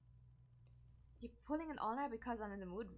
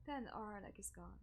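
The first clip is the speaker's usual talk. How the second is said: ordinary delivery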